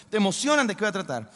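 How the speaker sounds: usual speaking voice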